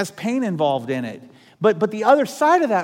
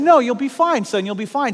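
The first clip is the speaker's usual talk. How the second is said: higher, louder fatherly encouragement; reassuring dad; 6 semitones higher, 4 dB louder than usual. Retold or imitated. Dad urging his nervous son, reassuring and upbeat. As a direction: cheer him on from the bottom.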